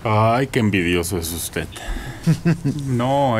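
ordinary talk

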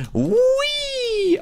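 long, high rising "wheee"; gleeful whee. Real, not acted. Player launching off the rainbow ball path, gleeful whee. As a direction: squeal a drawn-out whee, sliding way up.

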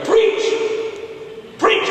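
high, punchy cheer from the front row; imitated deacon's yell. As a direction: egg the preacher on with a high yell. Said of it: "And they yell".

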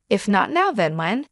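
plain reading voice

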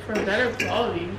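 ordinary delivery

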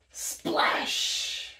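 sharp breathy gasp, then a hushed splash. Acted. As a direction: gasp as if the bear just fell in. Bear falls into the water.

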